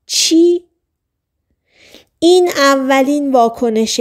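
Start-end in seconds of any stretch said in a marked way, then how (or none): 0.0-0.6 high startled cry of what; narrated scream. A narrator's reading. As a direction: shrieks it in sudden shock. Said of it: "جیغ زد".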